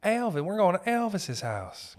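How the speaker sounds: high, insistent correction; exasperated Memphis hustler. Acted. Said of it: "And they're like".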